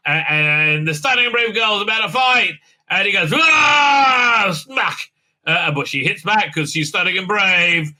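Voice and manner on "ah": loud high war cry; mock battle yell. Opponent charging Burnham, loud mock yell. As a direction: charge with a cartoon roar. Fighter attacks.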